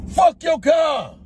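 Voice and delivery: yells it slow and furious; furious, drawn-out shout; angry shout. Real, not acted. Furious at spilled powder.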